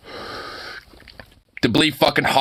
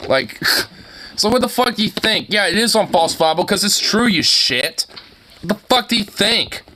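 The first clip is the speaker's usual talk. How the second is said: swearing tirade; 6 semitones higher than usual. Real, not acted. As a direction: blow up in disbelief, pitch soaring. Ranting at Matt Walsh.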